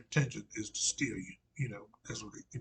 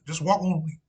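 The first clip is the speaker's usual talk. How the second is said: put-on the prophet quoted in a loud South Carolina accent; Southern Carolina accent; 9 semitones higher, 12 dB louder than usual. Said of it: "in that old South, Southern, uh, Carolina accent".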